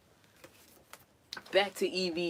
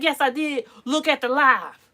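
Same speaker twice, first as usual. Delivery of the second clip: louder, higher comeback; a higher, louder told-you-so; 1 triumphant retort; 8 semitones higher, 11 dB louder than usual. Retold or imitated. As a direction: shoot back proudly at the doubters. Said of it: "I can be like".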